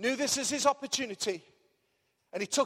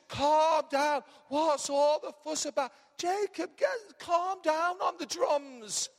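higher, drawn-out killjoy impression; mock placating; 5 semitones higher, much slower than usual. Killjoy telling Jacob to ease off the drums, mock-placating. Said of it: "You know, people just".

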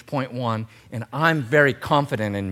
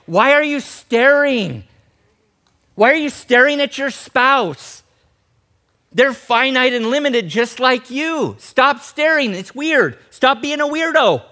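high, loud preacher's rebuke; mock-indignant shouting at the congregation; exasperated scolding; 9 semitones higher, 6 dB louder than usual. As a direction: call them out, stretching the key words. Preacher acts out scolding the starers.

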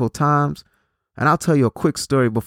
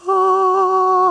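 mock crowd cheer; 4 dB louder than usual.

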